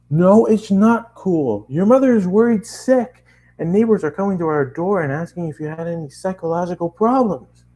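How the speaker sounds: hammed-up upset dad calling his son; angry dad voice. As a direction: play the furious father on the phone. Dad scolding on phone.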